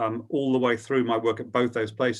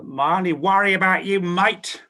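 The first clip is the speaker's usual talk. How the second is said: higher, louder Australian accent imitating his boss; 9 semitones higher, 6 dB louder than usual. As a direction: mimic a brash Australian news boss calling him mate. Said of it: "so he said in an Australian accent".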